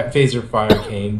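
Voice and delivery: usual speaking voice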